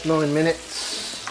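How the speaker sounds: drawn-out Brummy vowels on the time call; unguarded Brummy lilt, stretched out; Brummy accent. Real, not acted. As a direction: slip into a slow Brummy twang. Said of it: "I sounded brummy then".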